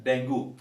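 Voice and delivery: 'dengue' as 'deng-goo'; Hindi newspaper spelling. Shown as a demonstration. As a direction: end 'dengue' in an 'oo'. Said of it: "where it is written".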